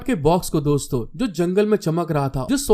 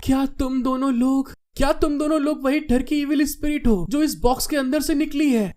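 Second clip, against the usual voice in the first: high, anxious halting question; nervous girl; 7 semitones higher than usual. A narrator's reading. Girl confronting two intruders about the box, nervous. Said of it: "घबराते हुए कहती है".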